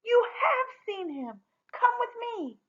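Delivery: high thrilled announcement; delighted. A narrator's reading. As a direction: tell her with joyful wonder. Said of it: "cried the sister in wonder and delight".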